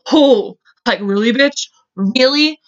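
indignant and scandalised; appalled disbelief. Real, not acted. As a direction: call out the suspect in real disbelief. Appalled at Lori's candid-camera remark.

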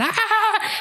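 high laughing mock scream; a playful imitation of her own old scream.

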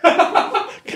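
high, helpless cackle; burst of laughter. Real, not acted. Co-host reacting to a colleague scolding a chatter, bursting out laughing.

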